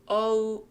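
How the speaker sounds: "oh" said as a gliding 오우. Shown as a demonstration. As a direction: glide "oh" from o to u. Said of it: "오우 발음입니다". ("oh"를 오우로)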